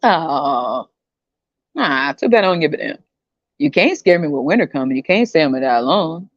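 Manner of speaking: usual speaking voice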